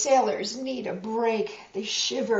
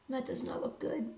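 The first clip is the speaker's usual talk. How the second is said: hushed, quick worried aside; whispered aside; 2 semitones higher, 11 dB quieter, much faster than usual. Real, not acted. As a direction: breathe it to the listener. Reader aside, storm.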